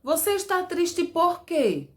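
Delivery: Você está triste por quê said slowly with a high questioning lift; asking example question. Shown as a demonstration. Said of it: "no final das frases interrogativas". (pergunta exemplo lenta e aguda, com por quê no final)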